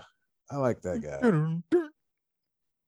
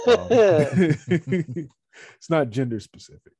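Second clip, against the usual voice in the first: laughing; 3 semitones higher, 6 dB louder, much slower than usual. Real, not acted. Laughing at own correction.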